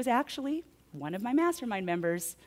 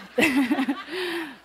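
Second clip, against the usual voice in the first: high laugh; 8 semitones higher, 8 dB louder than usual. Real, not acted. Laughs at the absurd night.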